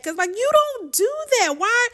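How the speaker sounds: high-pitched, exasperated disbelief; incredulous scolding. Real, not acted. Scolding the food snooper in retelling.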